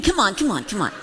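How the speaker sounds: usual speaking voice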